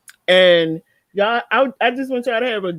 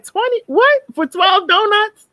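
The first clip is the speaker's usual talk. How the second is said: high, loud incredulous squeal at the price; outraged shock, slowing down on for twelve donuts; shocked disbelief; 12 semitones higher, 4 dB louder than usual. Retold or imitated. At the register, stunned by the donut price, high and disbelieving. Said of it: "I was like".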